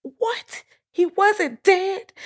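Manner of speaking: put-on high-pitched, disbelieving teenager squeal; shocked teenager. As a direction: play a stunned teen, voice way up high. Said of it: "I was like, a teenager".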